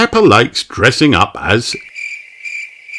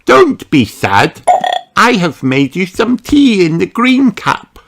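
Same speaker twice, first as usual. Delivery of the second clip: put-on high, kind little-girl voice; Peppa's voice; 9 semitones higher than usual. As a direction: comfort a friend like a small girl. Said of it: "said Peppa".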